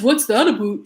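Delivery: put-on teasing Canadian accent on "about"; lightly exaggerated Canadian accent; mock Canadian accent. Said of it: "made fun of for my Canadian accent".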